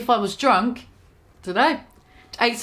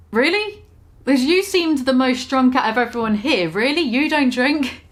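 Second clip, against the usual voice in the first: mock disbelief; 3 semitones higher than usual. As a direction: imitate baffled pub-goers doubting a sober friend. Imitating disbelieving people at pub.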